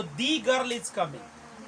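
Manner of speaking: put-on slow, with a long drawn-out "The" (dee) before girl; stressed the. Shown as a demonstration. Teacher showing students the emphatic the, drawn out slowly. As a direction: stretch "The" into a long dee, then say girl is coming. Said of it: "आप कहाँ पे कैसे पढ़ना है".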